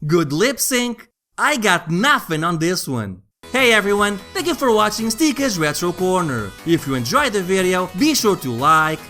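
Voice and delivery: ordinary delivery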